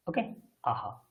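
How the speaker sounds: ordinary talk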